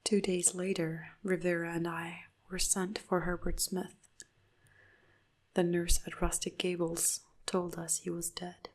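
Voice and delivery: usual speaking voice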